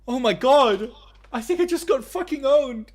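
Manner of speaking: high, loud laughing disbelief; stunned outrage. Real, not acted. Streamer reacting to the fake manager hanging up, loud laughing disbelief. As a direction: shout it like you just got owned.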